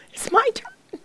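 high, broken, tearful voice; crying. Retold or imitated. A woman answering God in church, crying and high. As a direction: say it through tears, overwhelmed. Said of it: "I'm crying now".

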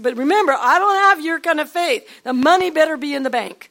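loud, high warning; husband's parting warning. Retold or imitated. Husband warning his wife on the way out, loud and high. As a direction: call it back over your shoulder, loud. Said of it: "He said".

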